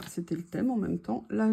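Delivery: ordinary delivery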